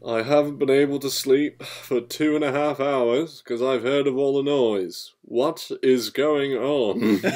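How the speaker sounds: pompous complaint, slowing right down to finish; indignant Fat Controller. Acted. Fat Controller in pajamas, woken by noise, indignant. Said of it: "The Fat Controller comes in in his pajamas".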